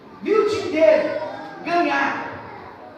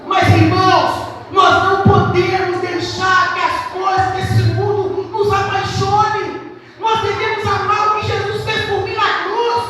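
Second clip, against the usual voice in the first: preaching; 3 semitones higher, 4 dB louder than usual. Acted. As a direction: press the point from the pulpit. Preaching at full force. (pregação fervorosa, mais alta e lenta)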